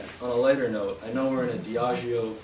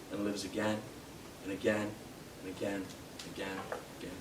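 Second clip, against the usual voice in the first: fading refrain; 7 dB quieter, faster than usual. Acted. Poet closes the Hiram Walker poem for an audience, fading away. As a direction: let each repeat die away after a pause.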